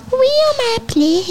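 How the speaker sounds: very high put-on voice; squeaky personified Pleasure; Pleasure character. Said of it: "Je suis le plaisir".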